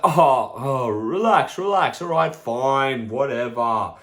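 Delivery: exasperated backing off. Retold or imitated. Friend told no at birthday, exasperated. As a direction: give in grudgingly, starting big and easing off.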